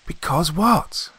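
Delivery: high, insistent rising question; sharp prompt. A narrator's reading. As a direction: press for the rest at once. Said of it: "I asked quickly".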